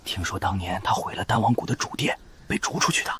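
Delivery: whispered rumour among onlookers; soft, breath-only murmur; hushed gossip. Acted. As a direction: pass on the scandal under your breath. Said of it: "嘘，小声点儿".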